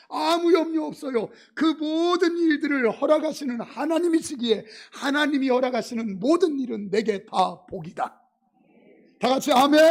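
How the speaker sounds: loud, rising preaching voice; fiery preaching. Acted. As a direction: preach it with full force. Preaching at full force. (힘찬 설교조)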